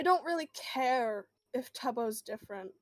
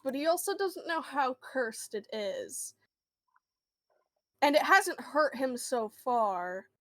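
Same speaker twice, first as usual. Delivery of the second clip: normal talking voice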